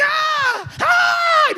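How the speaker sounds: very high shouted cry of rage, retold; furious cry. Preacher retelling his rage at the cracked windshield, high furious yell. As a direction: let out a high yell of fury. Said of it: "Me enojé tanto".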